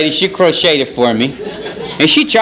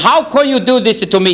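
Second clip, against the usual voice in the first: higher, mock wailing plea to God; hammed-up lament, pitched up; a rabbi crying out to God in mock despair; 7 semitones higher than usual.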